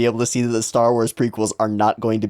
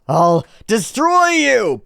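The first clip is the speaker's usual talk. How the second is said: slow, high, loud raging threat; berserk rage; 12 semitones higher, 5 dB louder, slower than usual. Acted. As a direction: roar the threat slowly.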